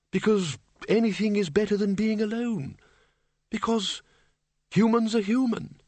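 put-on high, insistent old wizard; flustered Windle. A narrator's reading. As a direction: pour out reasons in a high, searching voice. Windle's impassioned answer.

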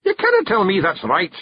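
high Scots protest; stubborn Feegle. Acted. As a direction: refuse to believe it. Rob's voice.

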